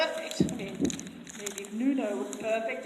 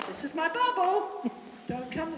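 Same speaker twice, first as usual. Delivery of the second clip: a lightly voiced mare, higher and firm; mare warning; 6 semitones higher than usual. A mare warning another horse off, higher and firm. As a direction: warn off like a bossy mare. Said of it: "a mare that goes".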